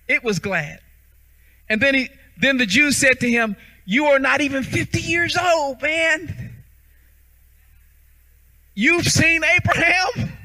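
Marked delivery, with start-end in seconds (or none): 3.8-10.4 incredulous mockery, stretched on the last question; scoffing disbelief.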